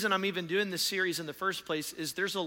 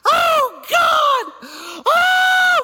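very high, very loud drawn-out wail; full-voiced imitated scream of panic; 14 dB louder, much slower than usual. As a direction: scream like a hysterical mother in an emergency. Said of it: "she is screaming".

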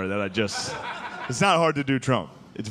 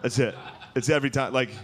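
normal talking voice

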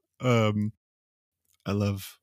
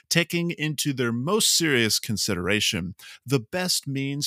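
higher-pitched, lilting proclamation; formal reading aloud; 6 semitones higher than usual. Real, not acted. Reading out the list of grievances, formal. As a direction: read it out like a solemn decree.

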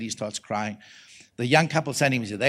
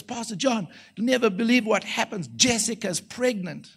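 high voice, slowing on the news; excited announcement; 9 semitones higher than usual. Retold or imitated. Pastor phoning with pregnancy news, high and excited. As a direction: share big news high and thrilled.

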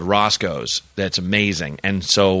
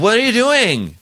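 high mock drunk girl squeal; high-pitched tipsy girl, a bit louder; 13 semitones higher, 4 dB louder than usual. Drunk girl chasing him, quoted.

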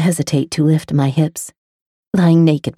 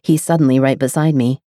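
usual speaking voice

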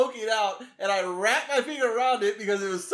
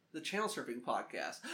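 usual speaking voice

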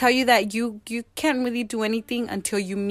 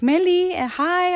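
higher-pitched, chirpy imitated hello; cheerful phone greeting; 8 semitones higher than usual. Social worker calls Meli with news, acted cheerful greeting. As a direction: greet someone in a lifted, singsong phone voice.

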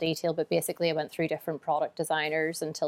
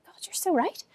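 high-pitched realization; 12 semitones higher than usual. Retold or imitated. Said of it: "And I was like".

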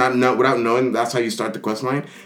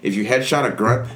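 ordinary delivery

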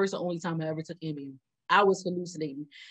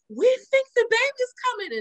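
team moms alarmed; 4 dB louder than usual. Retold or imitated. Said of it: "They like".

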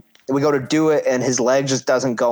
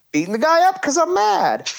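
much higher, excited push; worked-up telling. Real, not acted. Telling how he beat up his partner in anger, excited. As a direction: relive the moment with energy. Climax of angry match story.

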